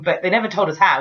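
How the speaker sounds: ordinary talk, nothing marked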